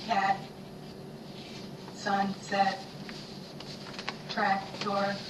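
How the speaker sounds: even, chopped robot syllables; robot speak. Shown as a demonstration. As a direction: chop each word into pieces like a robot. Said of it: "say these words like the robot".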